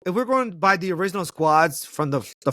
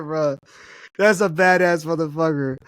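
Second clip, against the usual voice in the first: normal talking voice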